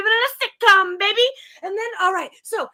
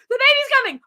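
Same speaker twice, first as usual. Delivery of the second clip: high, loud announcement of labour; mock alarm; 8 semitones higher, 6 dB louder, faster than usual. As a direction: cry it out in pretend panic. Acted alarm at sudden labour.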